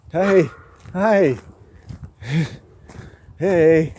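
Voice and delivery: high, loud sing-song greeting to dogs; calling to dogs. Real, not acted. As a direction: call out brightly to the puppies.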